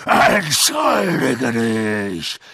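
slow loud snarled demand. A narrator's reading. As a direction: snarl it with menace. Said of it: "fauchte Hagrid".

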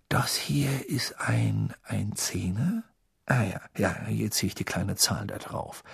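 soft, slow whisper over the notes; hushed puzzling. A narrator's reading. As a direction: puzzle it out under your breath. Arthur whispers over the money.

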